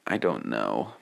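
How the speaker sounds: low, quiet grunt. Retold or imitated. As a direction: mumble it like a grunting brute. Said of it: "Grok voice just saying".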